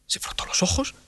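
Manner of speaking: whispered narration. A narrator's reading. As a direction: breathe the words out softly. Narración susurrada. (narración susurrada)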